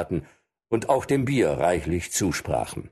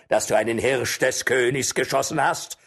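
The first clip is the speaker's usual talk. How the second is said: threatening; 6 semitones higher than usual. Acted. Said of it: "rief ein anderer".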